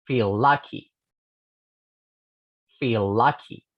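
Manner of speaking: "Feel lucky" with one shared l sound; linked consonants. Shown as a demonstration. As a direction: glide "Feel lucky" together. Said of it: "de la siguiente manera". ("Feel lucky" unido, una sola l)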